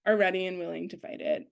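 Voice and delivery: ordinary delivery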